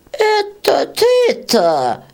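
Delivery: very high, slow mocking squeak; scornful beetle. Old beetle mocks the red peasant, high and slow. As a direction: sneer at the peasant's threat.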